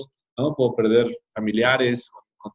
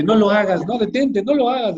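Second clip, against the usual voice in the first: TV comic character warning; 8 semitones higher than usual. Retold or imitated. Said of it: "aparecía Chabelo o el Chapulín Colorado".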